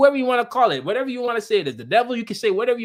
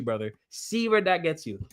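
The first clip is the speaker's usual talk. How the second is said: ordinary talk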